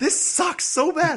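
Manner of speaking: high, loud, drawn-out complaint; hammed-up exasperated outburst; mock exasperation. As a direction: whine it loudly, stretching every word. Acting out character's frustrated complaint.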